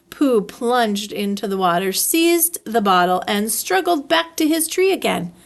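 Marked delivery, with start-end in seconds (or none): none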